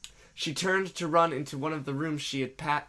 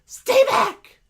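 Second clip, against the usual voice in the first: high, loud panicked shriek; scared yell; 13 dB louder than usual. Acted. Stacy yells back at the approaching woman, scared yell. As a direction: shriek it in sudden fright. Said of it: "Stacy yelled back, scared".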